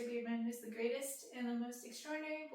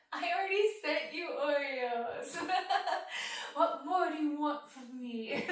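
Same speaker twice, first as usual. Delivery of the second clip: louder, laughing through the words; laughing tease; 4 semitones higher, 9 dB louder than usual. Real, not acted. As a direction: laugh out the mock complaint, slower at the end. Teasing fan who asks for Oreos.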